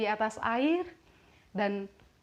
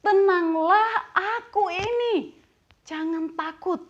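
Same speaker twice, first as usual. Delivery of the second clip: raised, drawn-out call of reassurance; Jesus; 9 semitones higher, 6 dB louder, slower than usual. Acted. Jesus calling to frightened disciples on the water, raised reassurance. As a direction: call it out high, stretching the first word. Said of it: "kata Tuhan Yesus".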